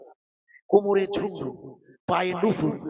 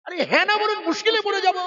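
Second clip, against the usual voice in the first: high, louder urging; excited prompting; 10 semitones higher, 4 dB louder than usual. Real, not acted. Preacher urging the congregation to respond, high. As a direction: push the crowd to answer. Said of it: "বলেছিল না, হ্যাঁ না বলুন".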